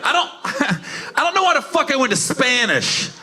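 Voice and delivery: ordinary delivery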